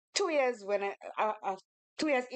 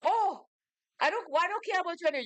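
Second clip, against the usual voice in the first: higher, fast, huffy mock of the rejected man; dismissive suitor; 7 semitones higher, faster than usual. Mimicking the rejected man brushing her off, higher and fast. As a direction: toss it off quickly like a sulking admirer, voice raised. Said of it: "He tell me".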